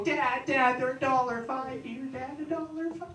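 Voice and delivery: put-on high, eager kid voice; a kid tugging at dad's sleeve; excited kid. Excited kid again, higher.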